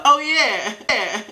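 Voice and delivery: high, sarcastic delight; hammed-up fake enthusiasm; mock eagerness. Mocks an eager acceptance she refuses.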